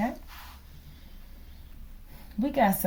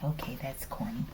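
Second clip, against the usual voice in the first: whispered aside; 7 semitones lower, 11 dB quieter than usual. Real, not acted. Aside after own joke.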